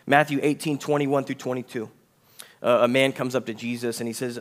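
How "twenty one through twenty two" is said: ordinary talk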